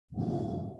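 imitated slow, soft exhale; calming breath. Client obeying counselor's call to breathe, imitated calming breath. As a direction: let the breath out audibly, easing down.

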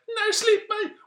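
very high, loud protest; hammed-up screechy refusal; Dronning Sonja. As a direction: squeal like a comic queen being grabbed. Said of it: "ikke høre på dronning Sonja".

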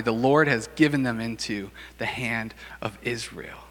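soft, secret whisper. Acted. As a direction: whisper it as if the enemy might hear. Jonathan tells his armor bearer secretly.

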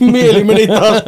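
words squeezed out through laughter; can't stop giggling while talking; laughing. Real, not acted. Laughing at the joke. (naurun läpi puhuen)

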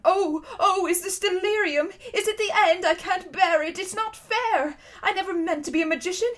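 high, self-pitying lament; wailing. A narrator's reading. Uncle Andrew protesting in the darkness, wailing. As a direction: wail in protest like a man at his wits' end. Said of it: "it was wailing on".

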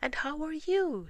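a chirpy little bear greeting his friend; cheerful Pooh voice. Acted.

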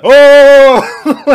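high, loud wild yell; hammed-up crazed cry; mock shout. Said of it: "he's like".

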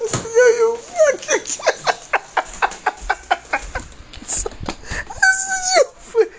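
high squealing laughter breaking through the words; can't get the words out for laughing; laughing hard. Real, not acted. Telling about the cops, cracking up in high laughter. Laughing fit retelling cops' prank.

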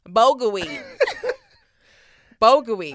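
"Bulgur wheat" in a weak American accent; a clumsy American "Bulgur wheat". Shown as a demonstration. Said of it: "two out of ten for that one".

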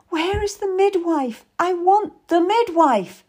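high, frantic demand; distressed demand. A narrator's reading. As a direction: cry out for help through the pain. Mother in great pain demands midwife.

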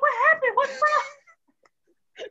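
very high squeaky mock voice; high teasing impression of Tierra. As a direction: squeak like a clueless friend. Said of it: "Tierra was like".

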